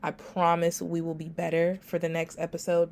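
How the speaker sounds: ordinary talk, nothing marked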